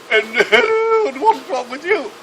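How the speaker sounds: very high, loud mock-regal hello; regal Queen. The Queen greets the next bed, in a high loud regal voice. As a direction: hail the patient like a hammed-up Queen. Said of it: "more regal".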